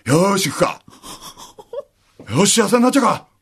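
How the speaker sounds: hammed-up eager guy pushing ahead; mock eager man. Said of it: "みたいなのって". (調子に乗った男の声)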